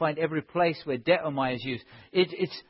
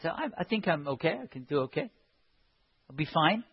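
soft, low self-reassurance; mock complacency; 3 semitones lower, 9 dB quieter, faster than usual. Preacher voicing a believer excusing himself, softly complacent. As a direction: shrug it off quietly.

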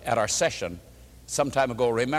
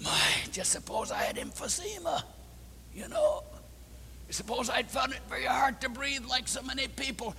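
hushed whisper, much softer, almost breathless; whispered aside; 9 semitones higher, 9 dB quieter than usual. Shown as a demonstration. Pastor whispers the thought between two prayers, whispering. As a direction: drop to a whisper as if imagining. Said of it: "we say".

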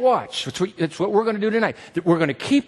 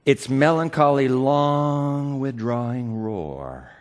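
slowly savouring "melancholy" and "roar"; slow, drawn out; 4 semitones lower, slower than usual. Shown as a demonstration. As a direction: stretch "long withdrawing roar" very slowly. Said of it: "Do you hear that line?".

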